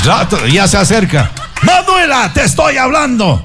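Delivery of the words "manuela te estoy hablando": high mock scolding; a hammed-up exasperated mother, pitched up. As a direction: Marta Julia scolding Manuela, high and exasperated.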